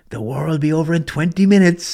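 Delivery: loud, high mock Irish accent. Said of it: "that's my best Irish accent".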